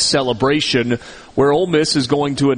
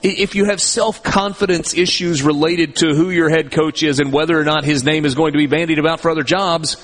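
usual speaking voice

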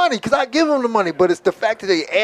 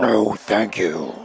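very low, drawn-out comic threat; mock menacing refusal; 13 semitones lower, 4 dB quieter, much slower than usual. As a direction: stretch it out slowly, deep down. Mock escalation of firm refusal.

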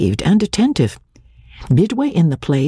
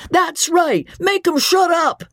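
much higher calling out from the crowd; crowd cries. A narrator's reading. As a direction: holler support from the seats. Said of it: "some cries of".

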